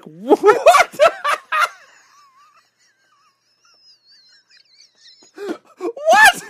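high, loud shocked cries with laughter; shocked what. Retold or imitated. Audience reacting to the dinner reversal, shocked loud cries. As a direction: scream it in disbelief. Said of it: "it's like".

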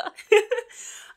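high, loud laugh; real amused laugh. Real, not acted. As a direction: let out a laugh. Host laughs.

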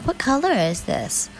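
high, sneering mock of the boyfriend; mocking his voice. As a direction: parrot his patronizing question back. Mocking boyfriend's patronizing question.

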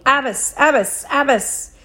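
high, repeated distant-style call. A narrator's reading. Rescuer calls the boy's name down the alley, calling out. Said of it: "my name echoed down the alley".

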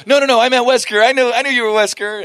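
high, loud mock protest; imitated protesting fan. Fan corrects himself to the actor, imitated loud protest. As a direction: blurt it out as a flustered fan.

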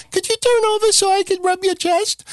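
high, put-on masseuse voice. Masseuse asking the guy to turn over, high put-on voice. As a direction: squeak it like a dainty masseuse. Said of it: "the fucking masseuse go".

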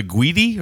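Guidi with a hard G, GWEE-dee; trial pronunciation. Shown as a demonstration. Host unsure of character's name, Guidi as GWEE-dee. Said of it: "I don't know how you say that name".

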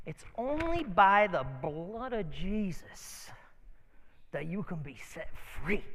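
slow, drawn-out declaration; solemn proclamation. Retold or imitated. Friend stops to tell a stranger about Jesus, solemn. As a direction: proclaim it slowly, word by weighty word.

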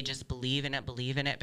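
soft mock mantra. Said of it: "those juju people that say".

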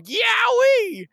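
high-pitched comic "yowie" yelp; drawn-out, high mock howl of pain; cartoon yelp. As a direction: yelp like a cartoon character scalded by lava. Retelling Satan leaping from lava.

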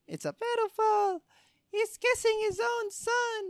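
high squeaky mock onlooker voice; mocking falsetto. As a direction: squeal it like a gossiping bystander.

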